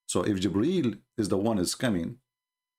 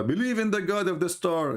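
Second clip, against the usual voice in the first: mock quote; 8 semitones higher than usual. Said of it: "he says to his cousin".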